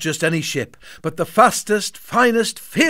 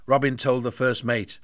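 ordinary delivery

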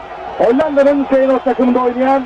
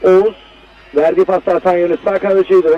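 usual speaking voice

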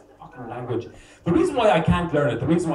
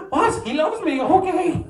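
much higher, hammed-up woman's voice; high mock surprised woman; 13 semitones higher, 3 dB louder, slower than usual. A Polish woman learning the man loves her, high mock surprise. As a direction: squeak it like a baffled woman catching on. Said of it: "Polish woman realising, voiced".

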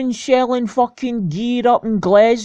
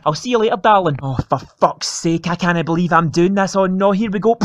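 ordinary talk, nothing marked

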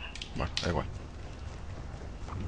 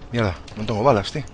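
ordinary talk, nothing marked

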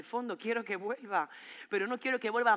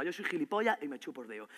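lower, contained annoyance; restrained sarcasm; 5 semitones lower, 4 dB quieter than usual. Acted. Boyfriend accuses her over a call, restrained sarcasm. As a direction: say it bitterly without raising your voice.

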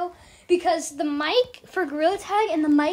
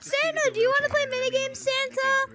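put-on excited kid; 5 semitones higher than usual. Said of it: "a kid who just has his dollar store mic".

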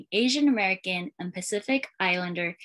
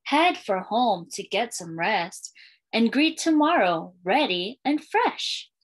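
put-on lilting read-aloud for young kids, refrain given room; storytime reading; slower than usual. Librarian reading a welcome book to schoolchildren, storytime lilt. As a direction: read it like a picture book to a class.

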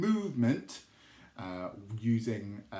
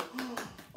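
gasp; 12 semitones higher, 7 dB quieter than usual. Acted. Delighted gasp before praise.

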